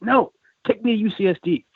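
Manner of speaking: high-pitched acted demand; insistent, voice pushed up high; retold stubborn refusal. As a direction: act out a passenger refusing to budge. Retold drunk stranger refusing to leave.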